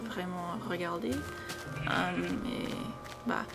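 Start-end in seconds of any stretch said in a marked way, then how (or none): none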